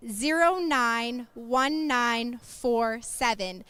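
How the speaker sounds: numbers read out slowly; slow number call. Real, not acted. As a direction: spell the number out slowly and clearly. Announcing winning raffle number.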